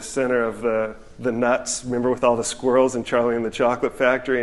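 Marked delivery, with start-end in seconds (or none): none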